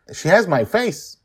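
a hint of a proud child; child Joy. A narrator's reading. Joy showing off her flag, lightly voiced child.

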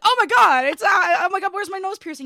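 high, loud mock panic; panicked. Retelling a panicked search for a lost nose piercing. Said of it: "'cause I was like".

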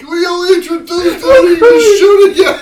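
high, loud, fast laughing words; laughing hard. Real, not acted. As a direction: shout it through tears of laughter. Hosts in fits of laughter.

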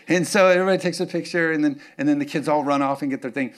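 normal talking voice

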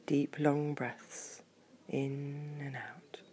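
slow soft breathing cues; slow down, guide breath; guided meditation. A narrator's reading.

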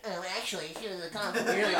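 soft, rushed know-it-all parody; fast, quiet teasing imitation; mocking mimicry. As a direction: parrot it back quickly in a put-on voice. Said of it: "people make fun of how, like, how m-, high my voice".

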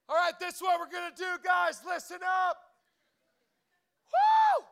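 high, loud, nervous hype; fake-pumped coach shouting to the team; nervous pep rally. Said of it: "First pep rally".

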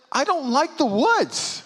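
high, slow pouty wife voice; a mock sulky wife, slow and high; imitating the disappointed wife. Wife complains in retold story.

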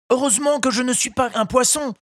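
high, quick little puppet voice; a relieved wooden boy talking to himself. A narrator's reading. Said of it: "se dit Pinocchio qui reprenait courage". (voix de pantin aiguë et rapide)

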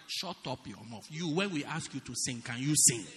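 ordinary delivery

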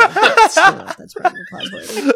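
high, loud laughing protest. Real, not acted. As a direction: talk through your own laughter. Joking about applause while laughing.

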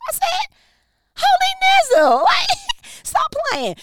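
very high, loud mock shriek; high shrieking laugh-cry of delight; shrieking exclamation. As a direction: squeal it in playful disbelief. Exclaiming to the Holy Spirit.

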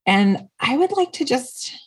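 usual speaking voice

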